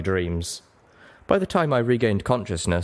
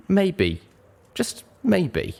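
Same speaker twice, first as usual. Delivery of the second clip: high, drawn out maybe, then falling; dawning realisation; 11 semitones higher, much slower than usual. A narrator's reading. Pony finally admitting her addiction to herself, hesitant. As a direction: let the maybe hang.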